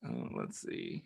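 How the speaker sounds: plain reading voice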